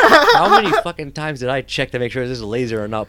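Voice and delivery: nervous laugh while telling it; half-laughing panic; laughing anxiety. Real, not acted. Anxious laser-gun retelling.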